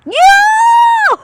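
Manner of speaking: loud, high cheer; excited whoop. Real, not acted. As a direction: yell with glee. Cheering at video.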